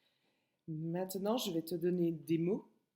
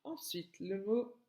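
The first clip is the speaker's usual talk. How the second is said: normal talking voice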